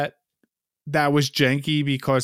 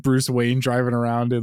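ordinary delivery

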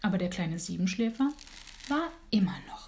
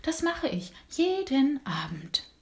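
slow, drawn-out snail voice, a bit higher; 6 semitones higher than usual. Acted. Snail tells the little dormouse about her evening run, slowly. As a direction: take it slowly like a sleepy snail. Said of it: "sagte die Schnecke langsam".

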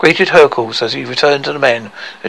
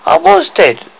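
put-on high young woman voice; a light, high princess voice; girl voice; 4 dB louder than usual.

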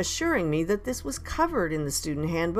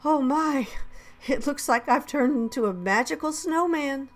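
high, playful mock wonder; playful pretend amazement; 8 semitones higher than usual. A narrator's reading. Teacher joking with giggling children, playful wonder. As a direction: marvel for the children's amusement.

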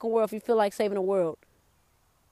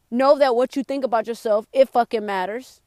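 usual speaking voice

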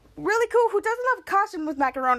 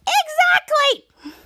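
exasperated; 14 semitones higher, 5 dB louder, much slower than usual. Retold or imitated. Piper exasperated at her dad.

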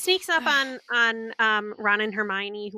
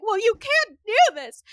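high, loud scolding Hermione; bossy Hermione; 9 semitones higher, 4 dB louder than usual. Retold or imitated. Hermione telling Harry off for breaking rules, loud high scold. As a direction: scold him like a know-it-all. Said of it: "Hermione's like".

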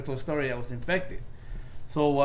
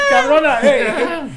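laughing exclamation; 12 semitones higher, 12 dB louder, slower than usual. Real, not acted.

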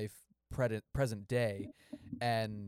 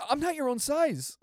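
high, louder mock of the line; mocking line reading; 6 dB louder than usual. Mocking the actor's line, high and loud. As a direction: ape the bad delivery. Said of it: "You definitely say it like".

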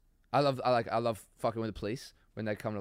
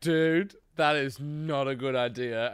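loud opening, then slow disapproval; emphatic warning; 3 semitones higher, 6 dB louder, slower than usual. Retold or imitated. Friend hearing a dumb plan, emphatic warning. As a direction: lean on each word to talk them out of it.

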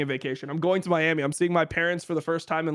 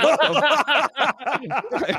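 shocked disbelief; 9 semitones higher, 4 dB louder, much slower than usual. Retold or imitated. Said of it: "you'd be like".

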